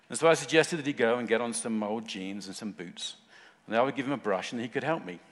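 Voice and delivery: ordinary delivery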